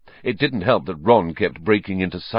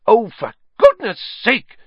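much higher, louder exasperated outburst; loudly; 8 dB louder than usual. Acted. Hermione interrupting the teacher, loud exasperation. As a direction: burst out, fed up. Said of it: "said Hermione loudly".

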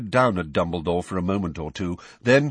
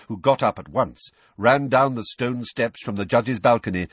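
usual speaking voice

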